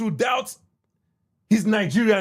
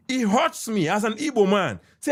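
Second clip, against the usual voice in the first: ordinary talk, nothing marked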